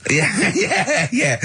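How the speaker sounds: high, laughing agreement, breaking into laughter; laughing yeah. Real, not acted. Guest laughing at the joke about fines for illegal traders. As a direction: laugh your way through the yeahs.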